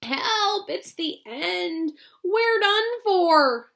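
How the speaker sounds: high drawn-out panic; panicked shout. Acted. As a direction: cry out in terror. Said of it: "and Grandpa Joe shouted".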